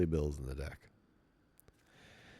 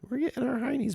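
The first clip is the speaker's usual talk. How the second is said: high squeak; 4 dB louder, faster than usual. Acted.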